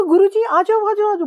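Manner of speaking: high, loud call to her guru. Retold or imitated. Reliving calling her dying guru into herself, loud high call. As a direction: cry out to him to come in.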